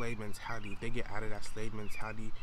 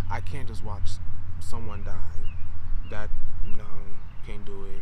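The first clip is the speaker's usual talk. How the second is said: upset, loud; 15 dB louder than usual. Real, not acted. Upset recounting Floyd video.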